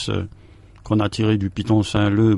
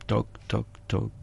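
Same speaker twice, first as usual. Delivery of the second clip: soft knocking; 11 dB quieter than usual. Acted. Knocking sound in poem.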